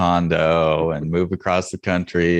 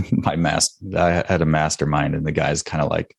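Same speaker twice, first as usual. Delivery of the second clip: normal talking voice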